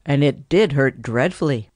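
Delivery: plain reading voice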